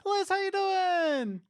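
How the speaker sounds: slow, high, drawn-out crooning greeting; mock smooth host. Mock gentle radio host.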